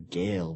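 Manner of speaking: put-on "gale" said like gale, an ay vowel for girl; Scouse girl. Shown as a demonstration. Liverpool speaker demonstrating the Scouse "gale" for girl. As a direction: say "gale" with a long ay sound. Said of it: "gale when they mean girl".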